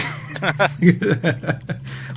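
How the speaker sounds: laughing at the memory; short, high, real laugh; high burst of laughter. Real, not acted. Amused at the rich CEO.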